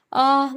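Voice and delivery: sudden hurt "aah"; sharp cry of pain. Acted. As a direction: yelp as the hot lid burns. Burns her hand on the lid.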